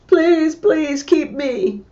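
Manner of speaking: put-on high, slow, begging little boy voice; pleading child. Clinging boy begs the wife to keep him, high pleading. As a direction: plead like a desperate small child.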